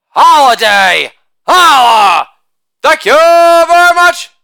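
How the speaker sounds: loud sing-song holiday chant; shouted catchphrase. Acted. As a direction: belt the tag line like a party cheer. Catchphrase chant after each joke.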